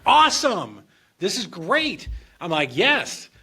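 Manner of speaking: elated whoop; thrilled. Retold or imitated. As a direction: cheer like you just got saved. Thrilled at the solution.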